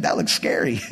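a hushed, frightened whisper; scared donkey whisper. Retold or imitated. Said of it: "He's like".

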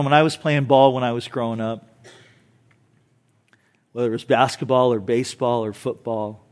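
ordinary talk, nothing marked

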